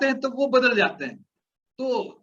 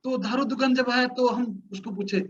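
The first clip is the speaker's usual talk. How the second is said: ordinary talk, nothing marked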